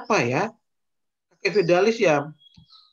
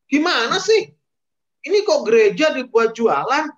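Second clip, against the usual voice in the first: high, loud indignant complaint; a fed-up Jesus scolding the traders; exasperated outburst; 6 semitones higher, 6 dB louder than usual. Acted. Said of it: "Tuhan Yesus mulai gemes nih". (Yesus kesal dan jengkel, bersuara tinggi dan keras)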